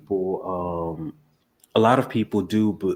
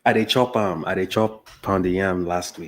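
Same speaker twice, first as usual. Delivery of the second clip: casual Nigerian Pidgin; Nigerian accent. Shown as a demonstration. Guest answering about pounded yam, Nigerian Pidgin. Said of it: "talk in your accent".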